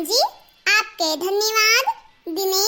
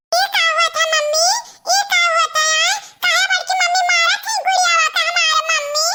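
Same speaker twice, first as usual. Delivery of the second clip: put-on questioning child; 9 semitones higher, faster than usual. Child asking mummy about the beating, high childlike voice. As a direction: pipe up like a puzzled child.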